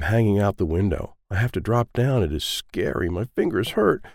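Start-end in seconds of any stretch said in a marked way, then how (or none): none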